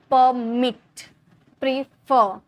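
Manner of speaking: "permit, prefer" with stress on mit and fer; stress on final syllable. Shown as a demonstration. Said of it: "थोड़ा जोड़ दिया जाता है उस पे". ("permit, prefer" के आख़िरी हिस्से पर ज़ोर)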